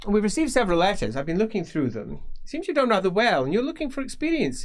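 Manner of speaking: high, brisk noble mage; Feuerbach, genial. Acted. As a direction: probe the apprentice's success. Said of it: "Feuerbach quizzing apprentice".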